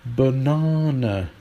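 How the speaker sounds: drawn-out "Banana", long ah in the middle; British banana. Shown as a demonstration. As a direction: say "Banana" slowly as buh-NAH-nuh. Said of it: "how we pronounce this word".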